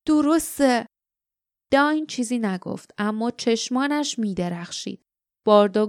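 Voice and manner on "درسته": higher, with a surge of hope; hopeful. A narrator's reading. Lief agreeing as hope floods in, lightly hopeful. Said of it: "موجی از امید بر او هجوم آورده بود".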